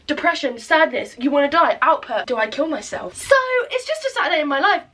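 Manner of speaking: usual speaking voice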